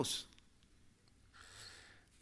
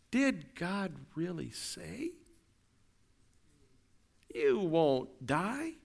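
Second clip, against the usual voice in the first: slow, hushed tempter's question; slow sly serpent voice; voice of doubt; much slower than usual. Acted. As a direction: drag out each word like a serpent doubting. Voicing doubt's tempting whisper.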